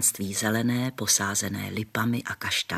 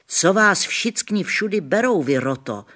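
higher, louder call from afar; 8 semitones higher, 5 dB louder than usual. A narrator's reading. Gamekeeper calling from the storeroom, loud. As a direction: call out to the crowd. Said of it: "volal odkudsi hlas".

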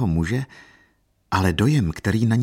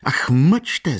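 high, louder proud outburst; vehement and proud; 7 semitones higher, 5 dB louder than usual. A narrator's reading. Katerina cutting off Dona Paolina, vehement and proud. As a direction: silence her sharply. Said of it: "zvolala Kateřina prudce a hrdě".

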